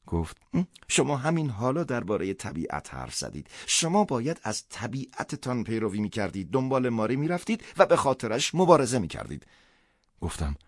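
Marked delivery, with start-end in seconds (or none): 0.5-9.5 a hint of a higher woman's voice, earnest; gently pressing woman's voice; lightly voiced woman. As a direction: voice her urging him, lightly raised. Woman's voice, held figure.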